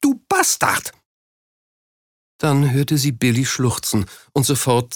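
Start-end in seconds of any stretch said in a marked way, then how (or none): 0.0-0.9 very high, strained insult; furious cry. A narrator's reading. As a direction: spit the insult out at a high pitch. Wife screaming at and hitting Jacko.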